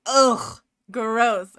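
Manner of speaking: loud mock "ugh, gross"; imitated disgust. Imitating people from Quebec seeing the shirt, loud disgust. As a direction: blurt it out in loud, mock revulsion. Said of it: "'Cause they are like".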